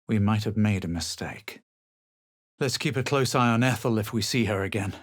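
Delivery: ordinary talk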